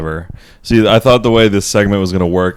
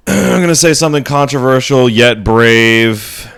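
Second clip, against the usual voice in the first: higher, louder mock-grand build-up; dramatic announcement; 5 semitones higher, 5 dB louder than usual. Real, not acted. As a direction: announce it like a bold confession. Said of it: "announcing a hot take".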